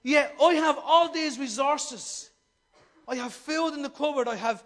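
loud, slow proclamation; proclaiming Father. Acted. Preacher speaking as God the Father, loud and slow. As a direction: declare it big and slow like a father.

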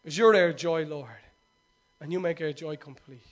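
ordinary talk

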